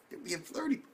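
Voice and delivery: high, sing-song teasing tease; mock sing-song ribbing.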